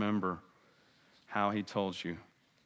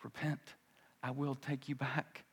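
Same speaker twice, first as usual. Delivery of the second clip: softer, tender calling of the Lord; gentle call; 3 semitones higher, 7 dB quieter than usual. Pretend. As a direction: invite back with quiet tenderness. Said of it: "calling to you".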